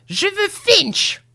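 high, slow, demanding cry; old woman's cry. A narrator's reading. Grandmother insists on seeing Finch, crying out. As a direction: draw the demand out at high pitch. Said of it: "cria-t-elle".

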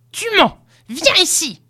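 put-on very high, irate old-woman shout; furious cry. A narrator's reading. Grandmother accuses Finch of lying, shouting furiously. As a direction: accuse and summon in a high-pitched fury. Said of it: "cria-t-elle furieuse".

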